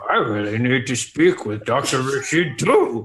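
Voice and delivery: slow, odd put-on dinosaur voice through a laugh; toy dinosaur voice. Player's toy dinosaur asks the girl for her father, slow and giggling. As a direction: play a little plastic dinosaur talking. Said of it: "And then I say".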